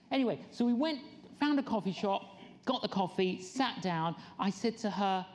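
usual speaking voice

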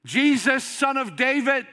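high, loud cry for help. Retold or imitated. Blind man calling out to Jesus, crying out. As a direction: call out at the top of your voice. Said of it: "crying out at the top of his voice".

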